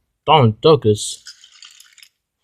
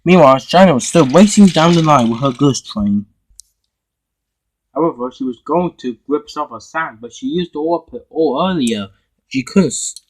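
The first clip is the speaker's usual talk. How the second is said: ordinary delivery, nothing marked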